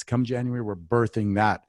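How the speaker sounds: ordinary talk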